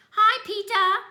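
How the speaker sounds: put-on high, loud bratty kid voice; little brother voice. A narrator's reading. Toddler taunting his big brother, bratty and loud. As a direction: pitch up loud as the cheeky toddler.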